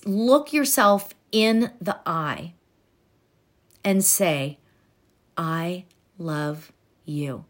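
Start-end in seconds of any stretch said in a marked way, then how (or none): 5.4-7.4 soft, lower and very slow; tender affirmation. Shown as a demonstration. Coach demonstrating a tender mirror affirmation. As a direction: say it gently to your own reflection.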